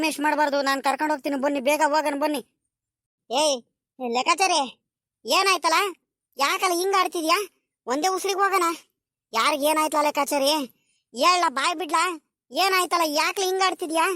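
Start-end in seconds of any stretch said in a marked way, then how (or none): 3.3-14.1 put-on high old voice asking what happened; a village elder fussing anxiously over the boy; worried old villager. (ಆತಂಕದಿಂದ ಕೇಳುವ ಹಳ್ಳಿಯ ಹಿರಿಯನ ಎತ್ತರದ ಧ್ವನಿ)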